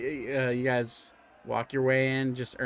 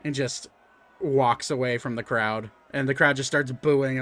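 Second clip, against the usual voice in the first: usual speaking voice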